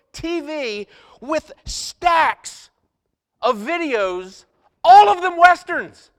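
slow, loud build to a high burst; punchline building to a shout; incredulous comic reveal. Retold or imitated. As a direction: draw out the discovery, then blurt it loudly. Comic reveal of the temptation found.

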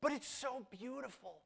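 high, entranced sigh of awe; a lightly voiced fly, lured and dazzled; dreamy fly voice. Pastor voicing the doomed fly drawn to the light. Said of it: "he says".

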